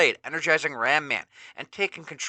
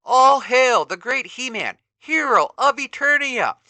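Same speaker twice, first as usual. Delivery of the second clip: much higher and louder cheering scream; villager screaming; 11 semitones higher, 8 dB louder than usual. Acted. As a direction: hail the hero at the top of your lungs. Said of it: "screams another villager".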